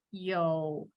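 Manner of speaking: "yo" dipped low and soft; third tone yo. Shown as a demonstration. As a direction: dip "yo" down low then up. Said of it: "as you change the tone".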